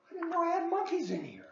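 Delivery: high, fast, squeaky mock surprise; playful high surprise. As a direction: act startled, joking. Joking aside about monkeys.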